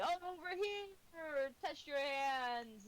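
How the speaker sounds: slow, high carnival barker cry; barker calling out. Acted. Carnival barker yelling to passersby, drawn out. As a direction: draw in the crowd like a barker. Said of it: "just yelling out".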